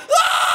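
very high, loud electrocuted shriek; full-force acted yell of pain; long shocked scream. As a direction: scream as if zapped in the mouth. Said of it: "I was like".

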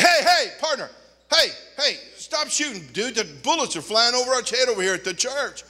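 preacher yelling at the man shooting birds; calling out. Retold or imitated. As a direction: shout across the yard.

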